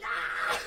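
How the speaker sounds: loud, high excited scream; imitated shriek of excitement; freaking-out scream. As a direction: re-enact two friends screaming at big news. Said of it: "like, freaking out".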